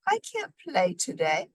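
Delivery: high, weak and tired; a sick moose calling out faintly. A narrator's reading. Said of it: "A weak voice called out".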